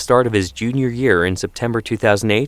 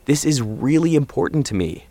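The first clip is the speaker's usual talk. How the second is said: higher-pitched earnest appeal; earnest son making his case; pleading; 7 semitones higher than usual. A narrator's reading. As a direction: plead with Dad, voice raised in pitch.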